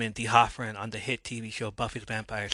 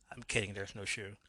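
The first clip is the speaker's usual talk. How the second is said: usual speaking voice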